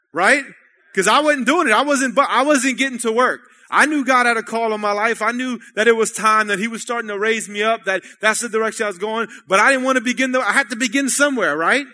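loud, rising sermon push; preaching at full force. Acted. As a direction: drive the point home to the congregation.

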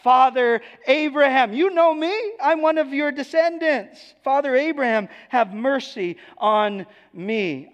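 high, loud begging, slowing on "have mercy on me"; rich man pleading. Acted. Rich man in hell begging Abraham for mercy, high and loud. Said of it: "And this rich man cries out".

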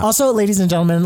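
lightly performed public proclamation; mock announcement.

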